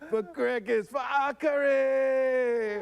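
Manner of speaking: high, drawn-out rallying shout; battle cry. Acted. Draka's battle cry shouted high and slow. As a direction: cry it out for the fallen. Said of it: "Okay, [name]".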